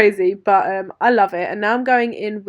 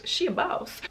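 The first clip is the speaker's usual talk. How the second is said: sassy, higher and softer "She a boss"; playful sassy aside, pitched up; 8 semitones higher, 5 dB quieter than usual. Pretend. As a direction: toss it off with sass. Repeats boss line with sass.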